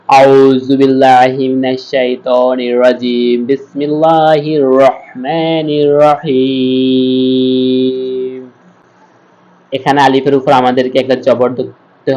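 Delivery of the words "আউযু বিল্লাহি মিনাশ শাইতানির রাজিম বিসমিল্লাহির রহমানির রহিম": slow, drawn-out chanted recitation; Quran recitation. Shown as a demonstration. Teacher opening the lesson, reciting slowly in tajweed. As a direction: intone it slowly like tilawat. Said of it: "আজকে এখান থেকে শুরু করব।".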